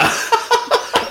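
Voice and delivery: breathless high giggle. Real, not acted. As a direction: giggle through it. Laughs mid-proclamation.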